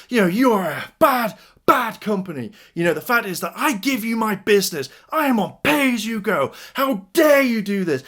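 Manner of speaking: high-pitched mock tirade; indignant shouting. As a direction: imitate an outraged customer, pitch jumping up. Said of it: "he starts shouting at me".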